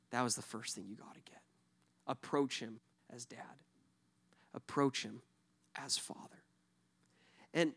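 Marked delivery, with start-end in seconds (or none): 0.1-6.4 hushed. Real, not acted. Pastor drops to key point.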